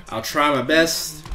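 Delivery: ordinary delivery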